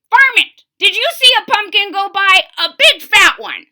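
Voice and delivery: put-on loud, high bellow of an old man; bawling old man. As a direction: bawl it out at the varmint. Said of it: "bawled out".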